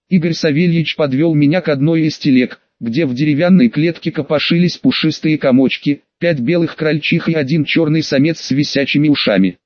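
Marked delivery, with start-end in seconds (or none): none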